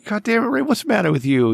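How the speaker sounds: high, mock-exasperated scold. Real, not acted. Host mock-scolding cohost Ray for a crude joke, high. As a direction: throw up your hands at Ray.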